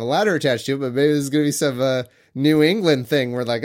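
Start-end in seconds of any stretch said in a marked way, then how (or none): none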